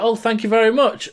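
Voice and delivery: high, mock-feminine thanks; imitated woman. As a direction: pitch it up as a flattered woman. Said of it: "And then she's like".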